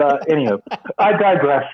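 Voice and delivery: normal talking voice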